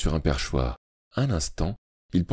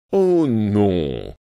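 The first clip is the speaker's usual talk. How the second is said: chuckling; 4 semitones higher, 5 dB louder, much slower than usual. A narrator's reading. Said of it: "gloussa monsieur Kilgrew".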